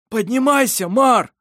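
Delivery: loud, high-pitched begging; urgent plea. A narrator's reading. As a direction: beg him loudly and urgently. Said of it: "попросил Ировар". (громкая настойчивая мольба)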